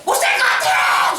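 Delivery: shouted cry of despair; mock complaining shout. Israelites shouting their complaint in the desert, despairing yell. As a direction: yell it like a people fed up. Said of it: "그 백성은 입으로".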